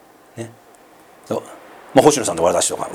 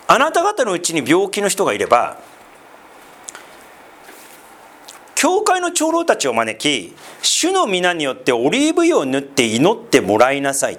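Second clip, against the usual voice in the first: scripture reading; 2 semitones higher than usual. Acted. Reads scripture passage aloud.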